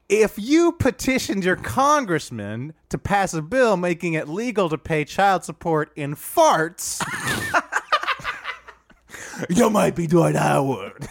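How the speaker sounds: Dwight Howard voice. Acted. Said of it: "It's a Dwight Howard thing".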